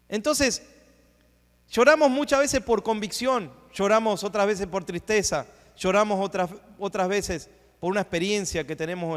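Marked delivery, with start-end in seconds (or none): none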